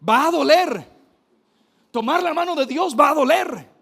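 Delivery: loud, high, fervent warning; booming pulpit declaration; forceful preaching. Real, not acted. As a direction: drive the warning home to the crowd. Preacher stresses painful truth.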